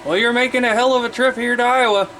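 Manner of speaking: ordinary talk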